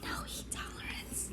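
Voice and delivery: teasing whispered aside; whispering. Real, not acted. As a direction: whisper it behind their back. Teasing aside about friend.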